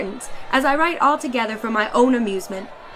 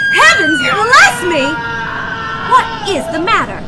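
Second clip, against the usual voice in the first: high, loud cry of alarm; startled cry; 11 semitones higher, 10 dB louder, slower than usual. Acted. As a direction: shout it out in sudden fright as the balloon gives way. Balloon suddenly collapses mid-letter.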